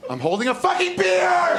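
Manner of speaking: high exasperated shout at his wife; husband yelling at his wife over an unseen beer, exasperated high shout; frustrated yell. Retold or imitated. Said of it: "so he goes".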